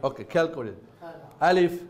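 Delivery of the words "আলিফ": alphabet recitation. Acted. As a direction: drill the letters for the class to repeat. Teacher recites letters as model.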